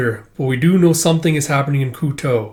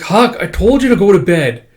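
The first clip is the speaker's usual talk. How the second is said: higher, louder exasperated telling-off; raised-voice retold reprimand; mock scolding; 7 semitones higher, 6 dB louder than usual. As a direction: scold a friend who should be in bed.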